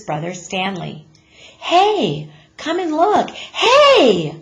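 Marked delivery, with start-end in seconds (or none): 1.6-4.4 put-on higher, louder boy's call from another room; child calling out. A narrator's reading. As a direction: shout to get his parents in here. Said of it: "Arthur called from the bedroom".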